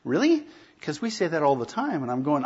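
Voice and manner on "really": high, rising surprised really; incredulous really. Retold or imitated. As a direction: imitate his startled disbelief. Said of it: "he looked at me like".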